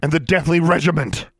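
high, loud accusing question; angry demand. A narrator's reading. Alexandra demanding answers from Darla, high angry shout. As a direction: hurl the question at her.

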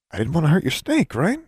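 high, fast defensive youth; young wizard voice. A narrator's reading. Magnificent answers.

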